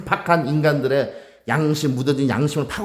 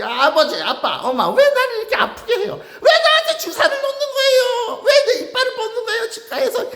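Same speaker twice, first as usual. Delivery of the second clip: put-on whining child. Child protesting to dad and mom at the dentist, high teary whining. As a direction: whimper like a kid getting a shot at the dentist. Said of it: "어린아이가 투정하듯이".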